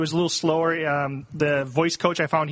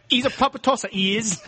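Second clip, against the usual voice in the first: higher-pitched mock Essex accent; 5 semitones higher than usual. Shown as a demonstration. Podcaster demonstrating phrases to co-hosts, Essex accent. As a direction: put on a cheeky Essex accent. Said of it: "Apparently, they say".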